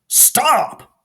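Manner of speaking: high, loud frog shout. Acted. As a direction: yell it across the field. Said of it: "Jerome shouted".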